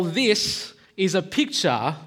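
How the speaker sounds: ordinary talk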